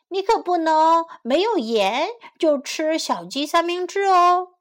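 high, loud chick voice; little chick. A narrator's reading. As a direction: pipe up as the clever chick. Pip stalls the fox.

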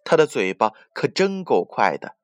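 plain reading voice

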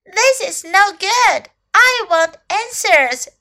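high, loud, slow complaint; frustrated cry. A narrator's reading. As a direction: cry out insistently as a little elephant. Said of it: "cried Little Elephant".

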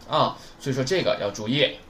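usual speaking voice

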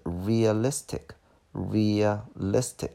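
realistic slowly, as rea-LIS-tic; stress toward the end. Shown as a demonstration. Teacher showing where stress falls, slow rea-LIS-tic for realistic. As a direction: spell out realistic by syllable, leaning on "lis". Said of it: "重音在后边".

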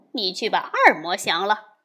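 ordinary delivery

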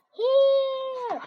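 high, loud call from inside the bubbles; child calling out to her mother, loud and high. Acted. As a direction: shout so Mother can find you. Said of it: "called Amanda".

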